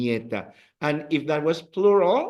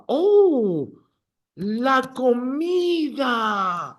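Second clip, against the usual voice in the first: excited shout; 8 semitones higher, much slower than usual. Acted. Teacher gushes to the class about food, excited shout. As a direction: shout la comida like a hungry fan.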